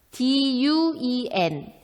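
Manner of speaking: 디유이엔 read slowly, letter by letter; spelled out letters. Shown as a demonstration. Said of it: "발음은 뚜원인데".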